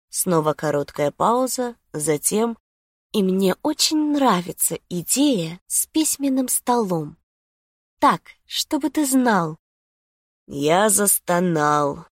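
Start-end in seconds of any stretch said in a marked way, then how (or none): 3.1-9.6 higher, put-on woman's voice; coy girlfriend. A narrator's reading. Lucy tells Felix she likes the idea, coy. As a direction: admit it coyly, pitched up.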